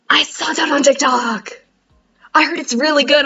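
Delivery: mock gasp then eager chatter; excited cousin. Cousin heard about the book on TikTok, lightly excited.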